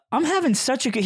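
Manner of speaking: high-pitched imitation of gushing; retold gushing. Friend gushes about the trip, mock gushing. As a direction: play up a friend's delight.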